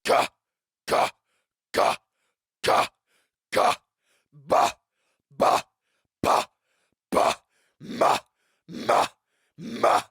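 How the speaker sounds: sharp puffs of air from the belly; consonant drill. Shown as a demonstration. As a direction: spit out each consonant. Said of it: "Remember to use proper breathing technique with this".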